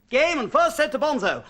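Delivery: proud mock umpire call; pretend umpire. Plays umpire of imagined match.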